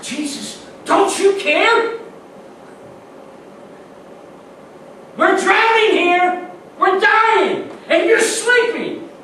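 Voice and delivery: high, loud pleading cry; frightened disciples shouting at a sleeping Jesus; desperate shout. Acted. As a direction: yell it like you're going under. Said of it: "exactly what the".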